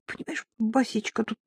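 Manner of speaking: weak, halting, reluctant explanation; limp mumbling. A narrator's reading. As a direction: mumble awkwardly, stalling. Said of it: "вяло забубнила я".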